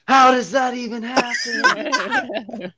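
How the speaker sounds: high, loud, drawn-out mock outrage; Kyle's mom. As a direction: imitate an outraged cartoon mother screeching. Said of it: "turned into Kyle's mom from South Park".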